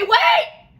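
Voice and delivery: very high, loud call; urgent shout. Acted. Piper stopping Missus B's count, high and loud. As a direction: stop them at once.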